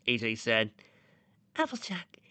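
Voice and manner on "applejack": high, soft-voiced indignation; mildly cross in a small, high voice; gentle character getting slightly annoyed; a little mad. A narrator's reading. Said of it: "getting a little mad".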